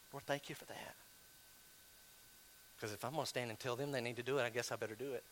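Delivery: very soft, low retold prayer; hushed and resigned; subdued, reluctant prayer. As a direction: say it under your breath, conceding. Retells his reluctant humbled prayer.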